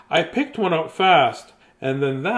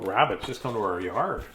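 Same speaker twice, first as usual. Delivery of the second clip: ordinary delivery, nothing marked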